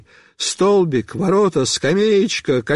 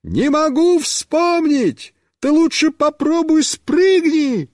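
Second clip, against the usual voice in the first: high, stretched calling voice; high-pitched holler; shouting; 10 semitones higher, slower than usual. A narrator's reading. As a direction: yell advice to someone riding by. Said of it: "Мишка снова кричит".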